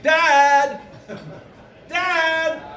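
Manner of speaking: high, drawn-out call; calling for Dad. Retold or imitated. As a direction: holler upstairs for a parent. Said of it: "I'm like".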